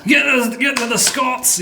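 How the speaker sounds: mock Scottish shout. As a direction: shout it like a cartoon Scot. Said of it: "The Scottish".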